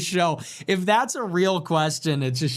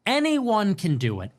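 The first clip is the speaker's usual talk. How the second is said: ordinary delivery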